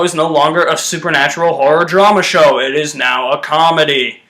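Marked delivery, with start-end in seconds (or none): none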